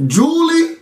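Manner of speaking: high plea to the host; calling out. Real, not acted. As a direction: call out to her like a plea.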